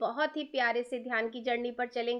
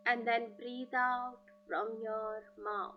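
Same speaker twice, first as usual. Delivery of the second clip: slow, drawn-out breathing instructions; calm breath coach, unhurried; guided breathing cues; 6 dB louder, much slower than usual. Acted. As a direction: stretch each word like a long exhale. Leads slow breathing exercise.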